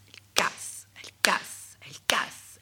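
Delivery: murmure à peine audible, sans voix; chuchoté. A narrator's reading. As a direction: chuchote comme un secret de conte. Conteuse chuchote le geste répété. (chuchoté, très doux)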